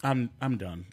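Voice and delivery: low, quiet, defeated grumble; mock despair, drop pitch and volume way down; fed up. Real, not acted. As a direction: give up on everyone, very softly. Fed up, gives up after absurd bill talk.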